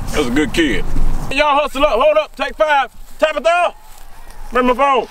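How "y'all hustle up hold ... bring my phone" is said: high, loud call across the field. Acted. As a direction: shout to the team and Tabitha. Coach calling across field.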